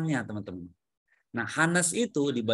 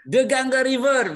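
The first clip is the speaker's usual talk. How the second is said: higher and louder confirming echo; loud model answer; 10 semitones higher, 8 dB louder than usual. Shown as a demonstration. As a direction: ring out the answer. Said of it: "Very good". (diucapkan keras dan tinggi sebagai contoh)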